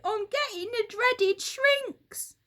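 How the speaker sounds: high, loud cry for help; Mister Twit begging for a doctor; high desperate shout; panicked yell. Acted.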